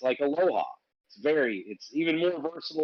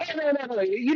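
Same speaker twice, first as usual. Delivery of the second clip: high reassuring no; 11 semitones higher than usual. Real, not acted. Reassuring a confused friend.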